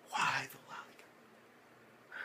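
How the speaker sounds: slow, drawn-out mock lament in a whisper; hushed, stretched plea; despairing whisper. Acts out despairing reaction.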